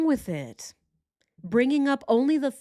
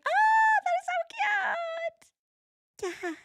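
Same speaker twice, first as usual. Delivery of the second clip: squeaky high polite cooing; fake polite gush. Friend pretending to admire parent's child, high fake coo. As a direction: fake delight, pitched way up. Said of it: "And I'm like".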